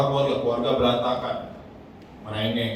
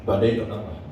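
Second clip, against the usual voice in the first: calm authority; 7 semitones lower than usual. Retold or imitated. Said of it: "berdiri dalam otoritas".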